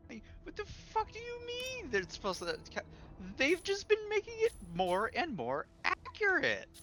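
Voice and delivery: high, strained incredulous protest; exasperated disbelief. Retold or imitated. Retold argument, baffled by opponent's claim.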